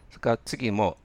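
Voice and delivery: ordinary delivery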